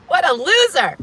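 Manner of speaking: high, loud burst with a laugh; amused, high-pitched outburst; laughing exclamation. Real, not acted. Laughs at own exasperated exclamation.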